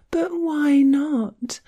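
very high, slow mocking whine; whiny voice. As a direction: drag it out in a whiny mock. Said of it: "I imitated her whiny voice".

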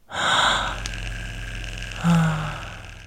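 long, airy exhale; long sigh. Acted. Narrator finishing the story, long sigh.

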